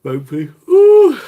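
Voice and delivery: loud high squeal; real excited squeal. Real, not acted. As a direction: let out a loud high ooh. Excited reaction on stream.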